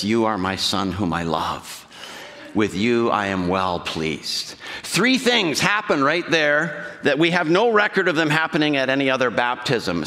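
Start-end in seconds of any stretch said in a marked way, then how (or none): 0.0-4.5 low, slow, loving voice of God the Father. Acted. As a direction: speak it lowly, slowly, like a father blessing his son. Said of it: "Jesus hears a voice that says".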